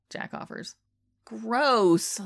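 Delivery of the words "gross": whiny. Acted. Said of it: "Lardo whines".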